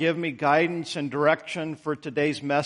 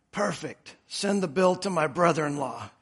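faint hoarse patient voice, much softer; weak raspy voice; 2 semitones lower, 5 dB quieter than usual. A narrator's reading. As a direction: rasp it out weakly. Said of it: "in a weak, raspy voice".

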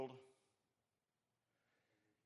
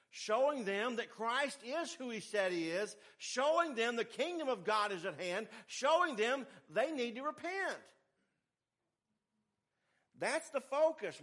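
higher, louder, building preacher's cry; rising sermon climax, louder with each Showing; preaching at full force; 9 semitones higher, 7 dB louder than usual. Acted. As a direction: drive each line harder like a revival preacher.

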